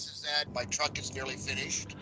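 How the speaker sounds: usual speaking voice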